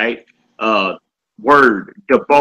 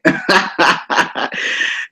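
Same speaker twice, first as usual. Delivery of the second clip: amused laugh; 6 semitones higher than usual. Real, not acted. Teacher amused by sister's message, laughing. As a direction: burst of high chuckling.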